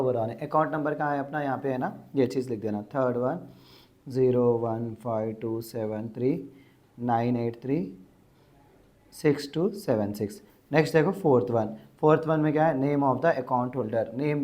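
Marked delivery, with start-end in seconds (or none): 4.1-10.4 slow, measured digit-by-digit dictation. Shown as a demonstration. Teacher dictating an account number for students to copy, slow dictation. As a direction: read out digits slowly, in spaced groups.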